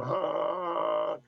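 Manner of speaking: drawn-out mock car horn; voicing the car horn blaring; imitated horn honk. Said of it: "[name]'s got the horn going".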